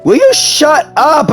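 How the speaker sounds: ordinary talk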